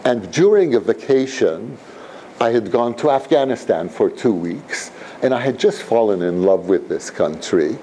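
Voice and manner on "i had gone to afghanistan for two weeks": usual speaking voice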